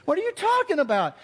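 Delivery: high incredulous protest; outraged hunters. Retold or imitated. Troy and David protesting to Cheryl, high and incredulous. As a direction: protest in disbelief, pitched high. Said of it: "and David were like".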